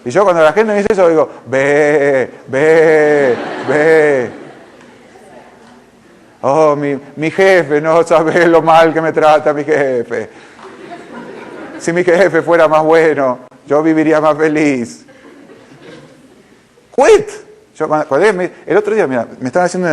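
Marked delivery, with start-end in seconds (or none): 1.5-4.3 long, loud mock sheep bleat; balido de oveja. Imita balido de oveja quejosa. 6.4-15.0 slow, drawn-out sheepish lament; mock whining. Parodia al empleado oveja que se queja. 16.9-17.3 loud, high one-word outburst; sudden shout. Acted. Corta de golpe la queja parodiada.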